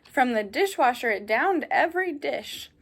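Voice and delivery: ordinary delivery, nothing marked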